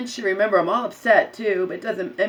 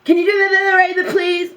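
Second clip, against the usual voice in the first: much higher, loud snapping demand; mocking snap; 10 semitones higher, 7 dB louder than usual. Imitating the receptionist snapping at her. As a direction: bark it like an impatient clerk. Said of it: "snapping at fucking me".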